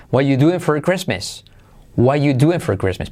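"What you doing for Christmas" starting "wa you"; verb omitted. Shown as a demonstration. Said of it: "omitimos todo el verbo". («What you doing for Christmas» sin verbo)

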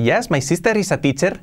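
hammed Spanish learner's English; Spanish-accented English. Shown as a demonstration. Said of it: "no tendrías mucha carisma hablando así".

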